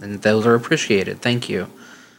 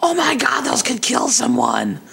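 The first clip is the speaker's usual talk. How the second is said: high-pitched imitation of the panicking ex; imitating her shrill sudden alarm; hammed-up girlfriend panicking over swords; mock freak-out; 14 semitones higher than usual. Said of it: "she starts to randomly flip out".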